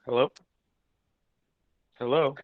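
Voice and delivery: ordinary delivery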